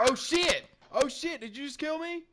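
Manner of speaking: high, loud startled yell; shocked shout. Real, not acted. Shot by surprise in game.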